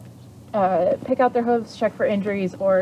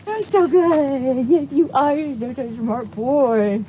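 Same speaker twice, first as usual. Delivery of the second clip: gushing praise; 4 semitones higher, slower than usual. Real, not acted. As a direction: gush over him slowly.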